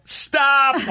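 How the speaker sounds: high, loud stop from the back; yelled stop. Retold or imitated. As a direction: cut the scene off sharply. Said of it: "And she'd yell".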